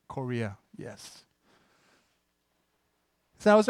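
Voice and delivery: ordinary delivery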